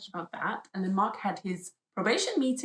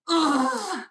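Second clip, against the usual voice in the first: frustrated groan; 9 semitones higher, 9 dB louder than usual. Real, not acted. Infuriated groan.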